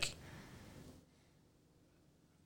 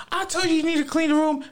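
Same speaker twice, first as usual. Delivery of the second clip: very high, loud, rapid mom yell; hammed-up shrieking parent impression; scolding mom; 5 dB louder, faster than usual. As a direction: screech it like a fed-up mother. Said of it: "your mom would come in and be like".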